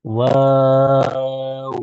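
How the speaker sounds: ওয়াও held long and very slow; stretched waw. Shown as a demonstration. Teacher showing kids how long to hold ওয়াও. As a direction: draw ওয়াও out for a full count. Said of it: "টেনে ধরে রাখতে হবে".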